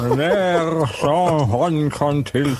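garbled around two corks; cork in mouth. Shown as a demonstration. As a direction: read with corks stuffed in the mouth. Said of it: "zwei Korken in der Fresse".

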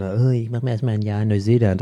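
put-on far-off voice of a privileged gap-year kid; lightly mock the rich kids. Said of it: "die typischen".

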